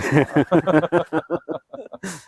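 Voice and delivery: a burst of real, loud laughter. Real, not acted. Guest laughing loudly at his own joke about the cold. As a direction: laugh out loud, then let it fall. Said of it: "Ini bercanda tapi serius".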